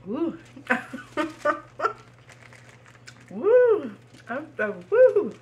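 loud, high, slow woo; spicy woo. Real, not acted. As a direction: shout it like the heat hits. Spice hitting hard.

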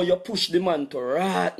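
normal talking voice

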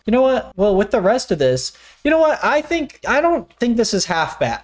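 pleasantly surprised. Real, not acted. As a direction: voice jumps up, surprised. Surprised change of opinion.

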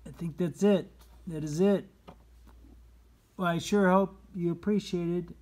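high, loud and bright; excited wrap-up. Real, not acted. Reader wrapping up the book for the children, high and loud. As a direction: finish the book with a burst of cheer.

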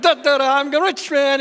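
high, loud, gleeful humming and bragging; giddy singsong. Retold or imitated. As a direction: skip along gloating. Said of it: "he's probably like".

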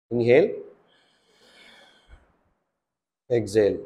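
loud breathing cues. Shown as a demonstration. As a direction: call out each breath like a coach. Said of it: "let me show you".

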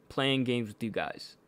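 ordinary delivery

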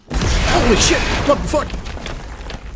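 jump-scared yelp, then breathless gasp; startled shriek, then a shocked whisper; scared horror game streamer. Real, not acted. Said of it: "That did s-, jump scare me".

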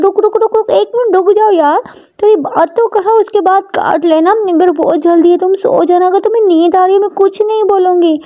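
high, urgent pleading; pleading Ishani. Acted. Ishani stops Prita from hanging up, pleading.

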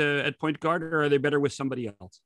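normal talking voice

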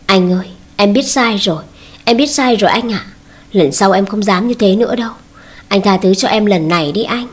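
usual speaking voice

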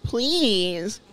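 begging, then turning cruel; plead, then go cold. Acted. Voices game dialogue.

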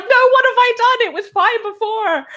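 high-pitched comic dismay, laugh creeping in; mock panic. Artist who overworked the piece, mock panic. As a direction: wail it as if the work is ruined. Acting artist's despair at work.

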